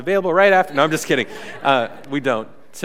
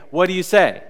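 usual speaking voice

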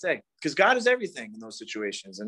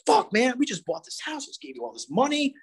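higher, indignant mock complaining; higher indignant grumble of a boyfriend who feels owed a cake; selfish ego rant; 8 semitones higher than usual. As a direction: voice the petty, entitled side of yourself, pitched up. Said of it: "the selfish part of me wants to be like".

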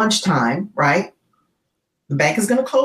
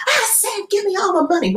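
high, menacing demand; robber's threat; 12 semitones higher than usual. Retold or imitated. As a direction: push the words at her face. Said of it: "he says".

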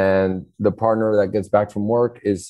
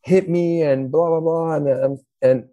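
put-on crying child; 7 semitones higher than usual. Child complaining to parents about brother, mock whining. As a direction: whine it like a hurt child tattling. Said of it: "child goes to parents like".

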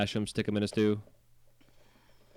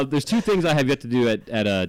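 ordinary talk, nothing marked

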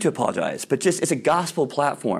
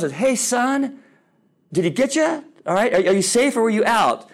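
calling from the sidelines; 9 semitones higher than usual. Retold or imitated. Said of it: "The dad from the sidelines yells".

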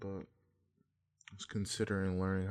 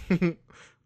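high, loud burst of giggles; 10 semitones higher, 14 dB louder than usual. Real, not acted. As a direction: break into a giggle mid-impression. Breaks up during own impression.

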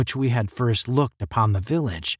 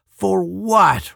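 higher, louder, uneasy query; unhappy, pitched-up demand to know; unhappy question; 9 semitones higher, 5 dB louder than usual. A narrator's reading. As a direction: ask it with rising unease. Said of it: "Randolph asked unhappily".

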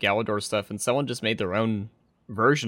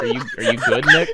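high, laughing mock concern; 7 semitones higher, 8 dB louder than usual. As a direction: squeak it out while laughing. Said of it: "It's like".

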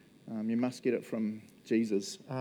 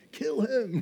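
mock angry mob; 8 semitones higher than usual. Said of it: "they're just like".

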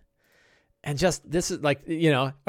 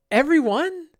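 incredulous; 13 semitones higher, 5 dB louder, slower than usual. Real, not acted. Incredulous at every goblin evil.

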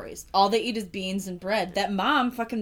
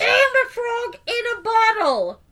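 much higher, slow squeaky voice; mock frog voice; 13 semitones higher, 5 dB louder, slower than usual. Friend keeps voicing the frog, slow high squeak. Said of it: "By yelling, you mean, like".

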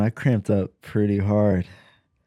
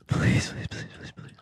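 breathless whisper, fading out; whispered pleading; 8 dB quieter than usual. Real, not acted. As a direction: beg in a whisper.

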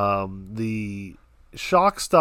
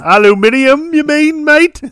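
put-on British accent; 13 dB louder than usual. British voice correcting aluminum.

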